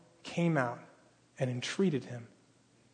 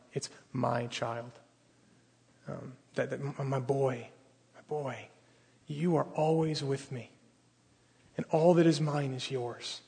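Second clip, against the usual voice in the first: tender; 4 semitones lower, 8 dB quieter than usual. Retold or imitated. Father gently answering his son.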